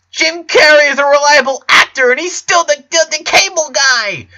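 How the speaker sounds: very high, loud, desperate; ranting in panic; shrieking boy; hysterical rant. A narrator's reading.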